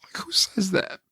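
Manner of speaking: can barely get the words out; tearful whisper. Real, not acted. Overcome recalling wife's words.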